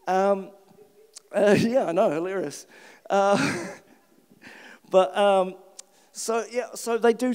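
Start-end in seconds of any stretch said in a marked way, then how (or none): none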